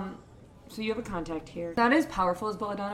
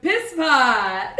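louder, laughing shout of the nickname; hearty yelled nickname; 5 semitones higher, 10 dB louder than usual. Acted. Bar contact greeting the player with a laughing shout. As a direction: bellow it like a rowdy old pal. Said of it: "He looks and goes".

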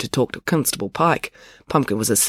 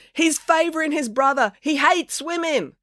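high-pitched accusing cry; indignant heckling. A narrator's reading. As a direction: accuse him from the crowd. Heckler accuses Finn from crowd.

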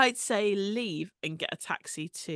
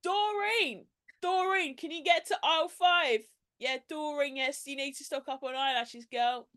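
higher-voiced store announcer calling a colleague; shop tannoy worker; 6 semitones higher than usual. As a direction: call over the shop floor. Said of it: "You could hear someone going".

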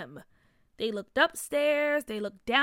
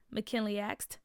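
ordinary delivery, nothing marked

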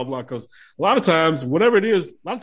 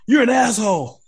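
high-pitched mock outrage; mock indignant fan; 8 semitones higher, 4 dB louder than usual. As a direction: hurl the insult like an offended moviegoer. Fan insults actor over movie.

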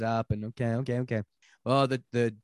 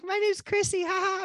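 mock Chrissy. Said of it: "She's like".

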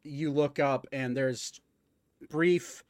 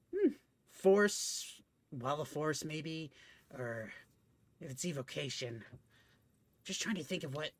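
musing bookish nerd, fading softer; 4 dB quieter than usual. Acted. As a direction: theorize aloud, trailing off. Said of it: "get my nerd voice on".

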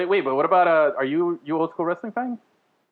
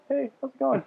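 high, polite little greeting; super polite; 10 semitones higher, 4 dB quieter than usual. Retold or imitated. Naked man greeting people as he walks by, super polite. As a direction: imitate an overly courteous passer-by. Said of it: "super polite".

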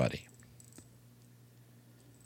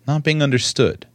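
ordinary delivery

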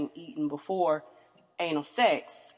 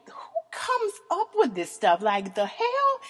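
high squeal of disbelief; incredulous; 11 semitones higher, slower than usual. Retold or imitated. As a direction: act mock shocked, pitched way up. Shocked reaction to a kink toy.

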